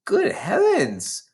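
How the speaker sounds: high, slow, shocked "good heavens"; shocked gasp. Acted. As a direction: gasp it out in disbelief. Shocked exclamation in story.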